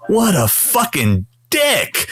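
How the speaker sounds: slow, high, drawn-out disbelief; stunned indignation. Retold or imitated. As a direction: stretch out each word in retold outrage. Retelling reaction to being snubbed.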